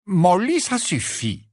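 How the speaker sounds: firm cut-in, loud on the name. A narrator's reading. Lupin stops Molly's outpouring, firm tone. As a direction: cut her off firmly, dropping at the end. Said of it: "répondit Lupin d'un ton ferme".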